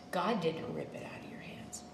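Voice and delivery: breathy whispered rebuttal. Real, not acted. Preacher whispering the truth to the congregation after voicing the devil. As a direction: drop to a whisper to set the record straight.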